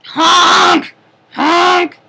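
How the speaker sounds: high, loud honking; shouted clown honk. Acted. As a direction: blast honks as singing. Clown tries to sing.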